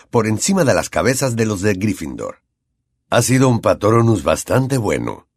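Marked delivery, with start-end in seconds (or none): none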